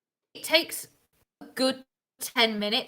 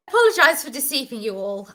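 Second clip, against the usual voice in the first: louder, quicker restart; in-character apology, louder; 4 semitones higher, 5 dB louder, faster than usual. Acted. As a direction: push the apology out faster. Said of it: "Shepard says".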